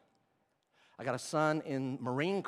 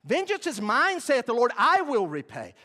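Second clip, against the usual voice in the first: higher and louder, declaring; proclaiming scripture; 7 semitones higher, 7 dB louder than usual. Acted. Preacher quoting the Lord to the church, loud proclaiming. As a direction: thunder the verse. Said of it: "I get to do that".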